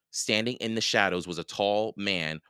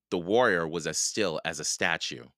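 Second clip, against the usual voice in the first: ordinary delivery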